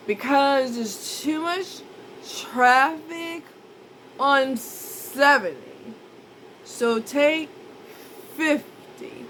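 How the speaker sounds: high, slow, dreamy voice of herself high; slurring stoned directions; drugged self quoted. Retold or imitated. Retelling drugged directions to Danielle.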